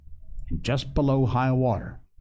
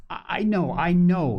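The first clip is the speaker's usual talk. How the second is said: higher, agitated outburst; crying out; 6 semitones higher than usual. A narrator's reading. Doctor answers the boy's objection, crying out. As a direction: cut in with an insistent cry. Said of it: "he cried".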